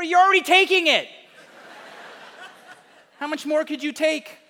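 high, hammed-up protest, loud at first; exaggerated indignant comeback; mock exasperated retort. County retorts in acted quarrel.

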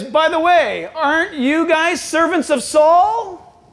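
high, drawn-out mock question; taunting Goliath. Goliath to Israel's army, drawn-out taunt. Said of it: "And Goliath says".

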